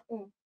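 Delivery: "اون" as a French nasal, no full n; nasal vowel. Shown as a demonstration. Teacher shows the sound standing alone, "اون" nasalized. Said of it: "به تنهایی این نزل هست".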